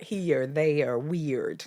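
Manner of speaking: put-on slow, lightly Glaswegian child; a hint of a Glaswegian kid's accent; Glaswegian twang. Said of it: "she's got these twangs".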